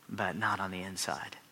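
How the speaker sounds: hushed, breathed secret aside; whisper. Acted. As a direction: whisper it like a hidden confession. Pretending to bow outwardly.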